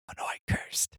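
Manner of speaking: hushed, breathy whisper. Acted. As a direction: whisper it so he can't hear. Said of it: "can he hear us whispering?".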